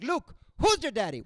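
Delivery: high, loud leering version; imitating dad's garbled Vader; dad's wrong quote. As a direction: blurt the mangled quote. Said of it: "He's like".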